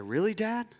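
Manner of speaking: imitating a surprised little girl, pitch climbing; incredulous child. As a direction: ask in high, rising disbelief, as a child. Daughter disbelieving father's ice cream offer.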